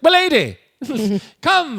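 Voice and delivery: high, loud mock-gallant call; grand gallant summons. [name] calling Lucy to the carriage, hammed-up gallant. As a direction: announce it like a showy suitor.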